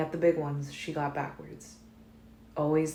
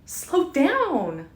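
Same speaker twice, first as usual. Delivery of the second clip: high frightened shout; 11 semitones higher, 7 dB louder than usual. A narrator's reading. Bella terrified in the speeding car, shouting high. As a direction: yell it at the driver in panic. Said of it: "Then she shouted".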